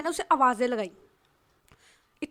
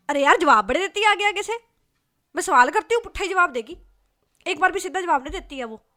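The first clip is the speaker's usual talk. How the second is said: loud, higher angry rant; a friend loudly ranting about her coworker's backtalk; 5 semitones higher, 8 dB louder than usual. Acted. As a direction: vent your fury at the rude coworker, loud and high. Friend rants angrily about coworker.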